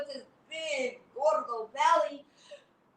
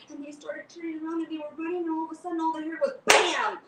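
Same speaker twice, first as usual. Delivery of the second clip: plain reading voice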